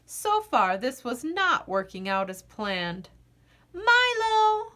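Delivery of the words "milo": high, loud call for the dog; girl calling her missing dog. A narrator's reading. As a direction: call out to find him. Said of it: "called Maggie".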